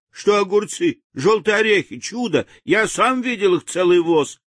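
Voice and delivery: high, eager exclamation; exclaiming. Acted. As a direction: outdo the other, thrilled. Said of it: "восклицал другой".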